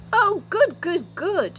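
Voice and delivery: high, loud delighted cheer; happy shout. Acted. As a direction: cheer with relief. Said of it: "shouted everyone".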